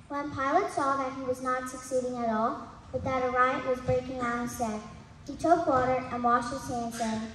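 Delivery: normal talking voice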